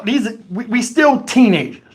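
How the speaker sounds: usual speaking voice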